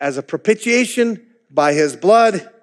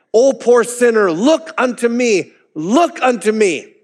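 higher, impassioned repeated plea; preaching as Jesus; 5 semitones higher than usual. Retold or imitated. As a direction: call out each look unto me as the pleading Christ. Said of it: "Assuming the perspective of Jesus, the preacher continued".

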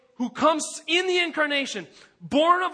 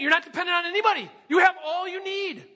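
mock sales pitch; 6 semitones higher, faster than usual. Mocking culture's message.

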